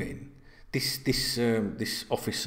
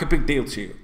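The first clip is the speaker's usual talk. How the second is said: ordinary talk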